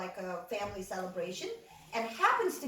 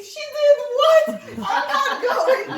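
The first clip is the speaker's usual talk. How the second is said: much higher, louder shrieking refusal; panicked outburst; 14 dB louder, slower than usual. Retold or imitated. Brother refusing the plane, high panicked shriek. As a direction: shriek it like he's horrified.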